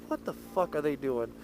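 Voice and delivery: low, hushed aside; hushed staff whispering about a couple; hushed disbelief. Retold or imitated. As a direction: mutter it to a coworker in disbelief.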